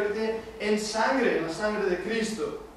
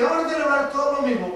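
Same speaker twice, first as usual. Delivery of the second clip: raised, quick papal proclamation; quoting the bishop; 7 semitones higher, 5 dB louder, faster than usual. Retold or imitated. Preacher voicing the bishop's order to the congregation, quick and raised. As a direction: announce it like a bishop's decree. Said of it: "y Julius dice".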